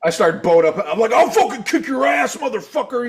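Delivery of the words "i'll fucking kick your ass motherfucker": high, loud mock shouting; hammed-up drunken threat. Drunk fan squaring up to boyfriend, mock-belligerent shout. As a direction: retell a blustering challenge to fight.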